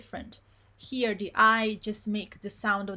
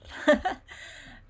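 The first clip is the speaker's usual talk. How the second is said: short laugh; 6 semitones higher than usual. Real, not acted. Laughs mid demo.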